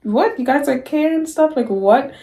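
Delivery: high-pitched retold shock, questions rising; shocked disbelief. Speaker retelling classmates' farewell notes, shocked disbelief. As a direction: act out being stunned that they care. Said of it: "so shocking to me".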